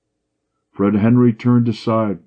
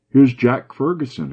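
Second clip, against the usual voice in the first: lightly raised exclamation, higher; exclaiming; 7 semitones higher, 4 dB louder than usual. A narrator's reading. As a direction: call it out as he spots him at the window. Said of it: "exclaimed Malcolm".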